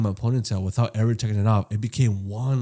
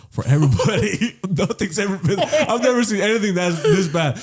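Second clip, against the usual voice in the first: higher voice, cracking up mid-quote; laughing disbelief; 8 semitones higher than usual. Retold or imitated. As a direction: retell the barber's amazement through laughter. Retelling barber's disbelief, laughing.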